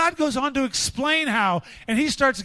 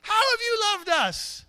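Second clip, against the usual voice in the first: put-on high, drawn-out cynical teen; high-pitched rebellious whine; oozing teenage attitude; 8 semitones higher than usual. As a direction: let the jaded attitude ooze out slowly. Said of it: "their attitude just oozes out".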